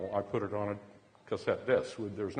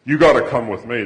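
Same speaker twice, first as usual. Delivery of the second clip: loud, insistent plea to a friend; acted-out urgent demand; urgent summons; 15 dB louder than usual. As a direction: call your friend over urgently and loudly. Girlfriend urgently summoned, retold.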